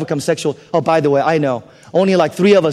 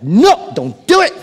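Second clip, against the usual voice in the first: mock forceful refusal; 6 semitones higher, 4 dB louder, slower than usual. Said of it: "just by saying".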